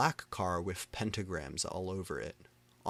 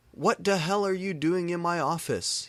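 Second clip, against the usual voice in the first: angry shout; 10 semitones higher, 6 dB louder than usual. A narrator's reading. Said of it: "he began to shoot angrily".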